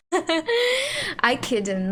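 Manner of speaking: breaks into a high laugh on the words. Real, not acted. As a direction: laughs while remembering it. Laughing recalling lockdown apart.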